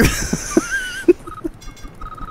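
very high wheezing laugh; helpless laughter. Real, not acted. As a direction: laugh until only a squeak is left. Cracking up at the wrecked park.

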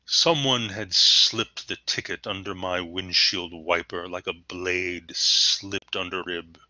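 slow, affected literary recital like Masterpiece Theater; pretentious, artsy, affected. Shown as a demonstration. Teacher parodying arty prose about a parking ticket, pretentious. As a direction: savour it slowly, as pretentious poetry. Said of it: "very pretentious, very artsy, and affected".

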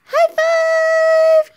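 high, loud squealed high five; cheering squeal. Real, not acted. Mother cheering baby's high five, loud high squeal.

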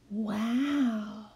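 hushed breathy wonder; breathed wow. Acted. Lily atop the tree seeing the town, breathed wow. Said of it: "breathed Lily".

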